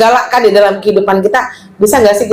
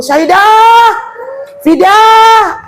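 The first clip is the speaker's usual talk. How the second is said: loud shout, much higher; Tarzan-like shout; 12 semitones higher, 3 dB louder, much slower than usual. Retold or imitated. Mother shouting her daughters awake for dawn prayer, a loud high yell. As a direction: bellow the names to wake them. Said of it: "suara saya kayak Tarzan".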